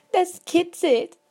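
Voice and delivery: put-on high, loud, slow giggly child; giggling little girl. A narrator's reading. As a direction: giggle it out, tickled. Child laughs as beetle crawls.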